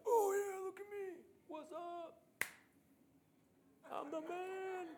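high-pitched show-off voice; hammed-up swagger, high and mostly held back in volume; mock boastful. Mock Jesus showing off after calming the storm, boastful.

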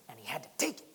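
breathy, secretive whisper; hushed whisper. Acted. Narrating Achan's greed, in a whisper. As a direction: whisper it like a guilty craving.

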